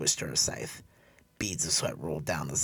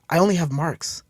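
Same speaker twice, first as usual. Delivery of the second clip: put-on high, loud, worried young woman; high, loud, upset young woman; dismayed young woman; 13 semitones higher, 11 dB louder than usual. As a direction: apologise in bright dismay.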